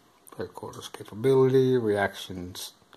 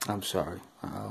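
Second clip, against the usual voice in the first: usual speaking voice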